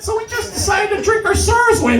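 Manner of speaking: very high, lightly voiced tipsy mom; drunk mom. Drunk mother explaining to her son, giddy. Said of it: "he's like".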